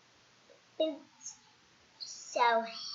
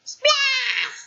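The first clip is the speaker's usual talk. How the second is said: high shouted splash; high loud splash effect; 12 semitones higher, 8 dB louder than usual. A narrator's reading. Coffee sound effect.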